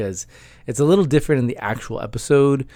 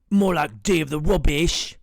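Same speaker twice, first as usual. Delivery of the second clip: British roommate quip; 4 semitones higher than usual. Acted. Roommate heckling the episode title in a British accent. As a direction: heckle from off to the side. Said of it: "why he talks like that".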